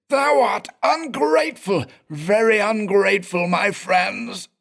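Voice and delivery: high, unearthly blubbering wail; sobbing spirit voice. Acted. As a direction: weep it like a reproachful ghost. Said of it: "sobbed the blubbering spirit voice".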